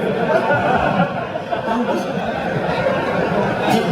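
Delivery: a quick breathy laugh before the gossip; real laugh. Real, not acted. Laughs before Paul Graham gossip.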